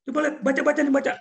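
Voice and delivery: high, fast, loud testing command; impatient boss. Retold or imitated. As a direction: rattle off orders to the worker. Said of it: "Saya kasih bacaan".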